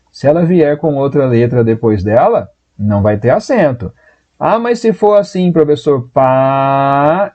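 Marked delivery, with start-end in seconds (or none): 4.4-6.1 questioning student. Retold or imitated. Voices a student's follow-up question. 6.1-7.3 "país" stretched into two long syllables; slow, split "país"; syllables drawn out. Shown as a demonstration. Shows the word split in syllables.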